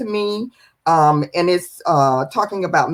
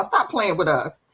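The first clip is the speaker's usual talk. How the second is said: normal talking voice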